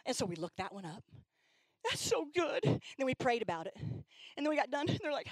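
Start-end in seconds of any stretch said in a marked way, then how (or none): 1.8-2.6 high excited exclaim; excited teen. Retold or imitated. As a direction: gush it with delight. Girl excited again.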